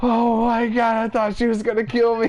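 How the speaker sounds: high, loud panicky cry; mock frightened relief. Stoned guest learns mom was joking, hammed-up panicked relief. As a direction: gush it out high, as if barely spared. Retelling his relief after scare.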